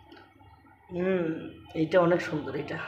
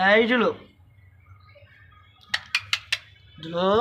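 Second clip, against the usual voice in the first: calling out; 4 semitones higher than usual. Real, not acted. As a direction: call the pet over by name. Calling the animal by name.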